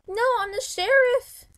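high loud excited call; excited shouting. Real, not acted. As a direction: shout it out thrilled. Game role reveal.

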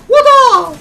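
very high, loud yelp of disbelief; shocked shriek. Real, not acted. Shocked reaction during game.

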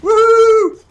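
very high, loud whoop; celebratory "woo" shouted high; triumphant whoop. Real, not acted. Celebrates a play in game.

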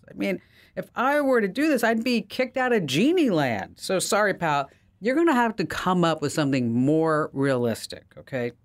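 high, incredulous genie refusing a bridge; exasperated genie. Acted. Genie to the man wanting a bridge, high incredulous. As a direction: play the genie boggled by the request. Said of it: "the genie leans back and goes".

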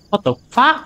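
high-pitched startled swearing; startled disbelief. Real, not acted. Player finding a missing-person notice, startled.

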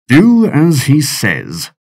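slow, grim, put-on captain's voice; grim order. Captain telling his soldiers to give in, slow grim order. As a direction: say it slowly through your teeth.

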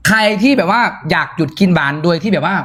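usual speaking voice

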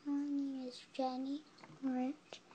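soft, barely audible name; mumbled shyly under the breath; too quiet. Real, not acted. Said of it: "Can you say that a little louder?".

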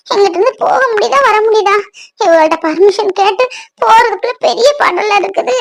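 crying while complaining, with sniffly pauses; tearful lament. Acted. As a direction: lament through tears. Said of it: "எதுக்குப்பா அழுதுகிட்டே இருக்க?".

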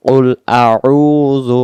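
আউযু slow, split as a and long throaty উ; ayn pronounced. Shown as a demonstration. Teacher showing how to say it, আউযু with full ayn. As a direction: hold the throat-made উ in আউযু. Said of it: "এই শব্দটা যখন আমরা ব- বলবো".

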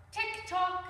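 high, loud playful tick-tock; clock imitation. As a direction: chime out the clock's beat like a child. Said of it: "he said when he saw the clock".

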